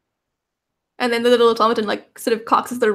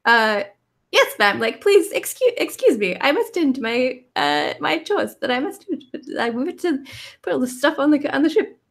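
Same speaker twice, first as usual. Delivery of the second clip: higher, cute little robot voice; chirpy tiny automaton, higher pitched; 5 semitones higher than usual. Acted. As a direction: play a sweet little copper robot. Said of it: "a real cute little, like, a little robot-y voice".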